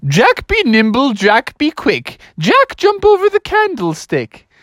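high, singsong teacher goose; Mother Goose. Acted. Substitute teacher answers in rhyme, high singsong goose voice. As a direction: recite high, lingering on the rhyme. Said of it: "And she said".

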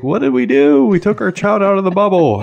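mock first-time-parent panic, high-pitched and loud; freaking out. Dad retelling his panic over daughter's first germs, freaking out. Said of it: "we're just, like, freaking out".

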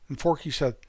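ordinary delivery

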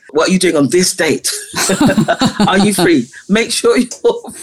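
laughing, rising high and eager; playful giddy urging, voice climbing; excited. Retold or imitated. Calling choir to book date.

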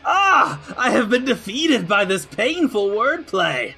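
high, wailing cartoon villain; defeated villain. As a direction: melodramatic defeat, hammed up.

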